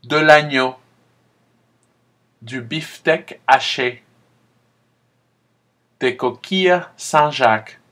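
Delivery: spaced, clear teacher model; model repetition. Shown as a demonstration. Teacher reading vocabulary for students to repeat. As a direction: give each word alone with a pause. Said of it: "Répétez".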